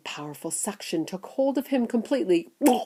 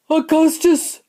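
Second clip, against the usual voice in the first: high loud call; 10 semitones higher, 7 dB louder than usual. Acted. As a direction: call him sharply. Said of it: "called out Missus Gloop".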